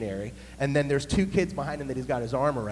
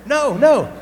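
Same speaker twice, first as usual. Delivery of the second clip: much higher, louder flustered no; loud protest; 11 semitones higher, 11 dB louder than usual. Retold or imitated. Pastor correcting the boy, loud and alarmed. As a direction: stop him in a panic.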